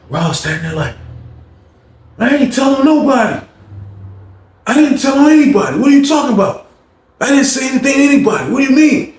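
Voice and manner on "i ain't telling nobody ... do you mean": high, whiny protest; Rob pleading innocence. Retold or imitated. Rob denying snitching to James, high whiny protest. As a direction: deny it, rattled and fast.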